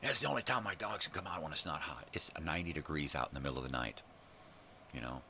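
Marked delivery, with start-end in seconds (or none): 0.0-2.1 higher-pitched mocking imitation; mocking neighbor. Lady excuses leaving dogs out, mockingly imitated. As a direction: mimic her excuse in a put-on higher voice.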